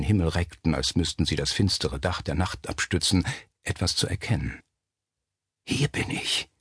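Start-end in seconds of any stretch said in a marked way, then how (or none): 5.7-6.5 hushed, voiceless whisper; barely audible ghostly whisper. A narrator's reading. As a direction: whisper it softly out of the dark. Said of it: "Das Flüstern war ebenso leise wie zuvor".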